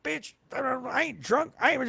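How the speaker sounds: put-on high, slow slurred drunk protest; drunk uncle. Drunk uncle denies it to the driver, high and slow. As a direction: whine it like an indignant drunk uncle. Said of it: "My drunk uncle".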